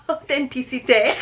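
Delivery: Authenticité said the French way, through a laugh; French accent. Shown as a demonstration. Unboxing host laughing through her best French accent. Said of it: "that was a hard thing to try to say all Frenchy".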